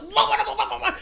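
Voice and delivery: high-pitched comic "wah wah" noises; mock high-pitched freak-out noise; imitated wailing. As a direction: babble high wails, mimicking someone overwhelmed. Imitates man reaching into the Void.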